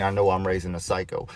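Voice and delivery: usual speaking voice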